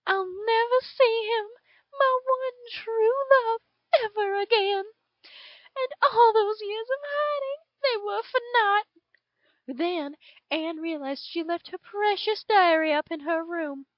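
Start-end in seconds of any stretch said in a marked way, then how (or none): 0.1-8.9 high, loud, voice breaking with tears; grieving lament. Acted. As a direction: pour out the hopeless grief. Anne grieving, taken by soldiers.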